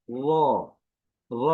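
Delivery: heavy, full "র"; thick R. Shown as a demonstration. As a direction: say "র" thickened, each one separate. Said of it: "মোটা হবে".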